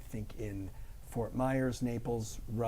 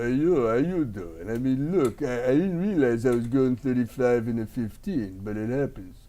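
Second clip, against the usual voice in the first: lightly hammed-up excuse to a cop; put-on character voice; 4 dB louder than usual. Comic acts out talking to traffic cop, put-on voice. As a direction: play the caught driver in character.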